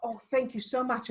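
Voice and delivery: high, delighted thank-you; imitating a sweet grateful woman; mock gushing thanks. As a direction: overflow with grateful relief.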